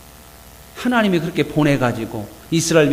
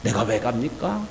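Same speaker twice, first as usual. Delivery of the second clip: mild grumbling complaint; 6 semitones lower, 9 dB quieter than usual. Acted. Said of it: "불평이 가득해서".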